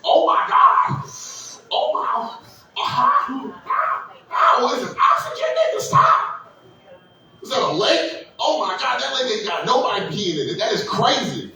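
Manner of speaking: squeaky, high voice of awe at nature; wide-eyed city guy discovering fresh air; amazed outsider. Retold or imitated. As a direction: gasp at trees like you've never seen them. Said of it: "you're like".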